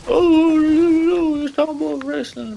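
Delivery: shrill sneering parody of a wrestling YouTuber; mocking impression. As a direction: whine it up high like a dumb fan.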